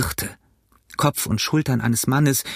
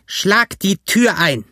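high, drawn-out roar; slow, high yell of a ringleader; shouted command; 8 semitones higher, 4 dB louder, slower than usual. A narrator's reading. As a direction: bellow an order to break in. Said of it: "denn er schrie".

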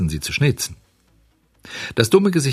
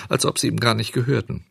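usual speaking voice

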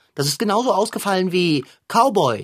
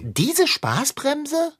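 mocking; 9 semitones higher, much slower than usual. Cat mocks Frau Sparbrot.

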